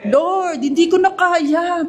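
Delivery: high, strained plea; a weary believer admitting defeat to God; worn-out plea. Pretend. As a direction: beg the Lord you can't go on.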